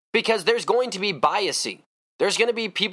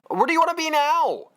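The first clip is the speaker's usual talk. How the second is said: much higher, mock exasperated asking; exasperated question; 12 semitones higher than usual. Answering the [name] impression, high mock exasperation. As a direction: throw the question back in disbelief.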